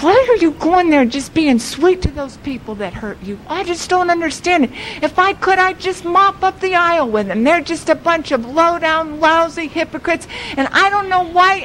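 high, rapid indignant outburst; acting out a mad husband's tirade; retold angry rant. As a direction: rattle off his grievances at a heightened pitch. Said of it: "was so mad".

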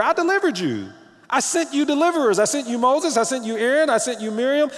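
loud, high preaching voice. Acted. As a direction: proclaim it like a preacher. Preaching God's words.

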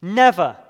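"Never" punched out loud and falling; emphatic never. Shown as a demonstration. Preacher stressing Peter's word to congregation, loud emphatic. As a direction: hit "Never" hard, one firm word. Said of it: "He is emphatic".

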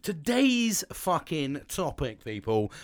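high, loud, slow showman; hammed-up announcement. Announcing topic dramatically.

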